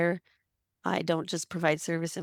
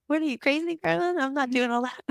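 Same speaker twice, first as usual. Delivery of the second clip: much higher, incredulous teasing voice; mock past self; 8 semitones higher than usual. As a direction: scoff at the idea as your old self. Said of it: "Be like".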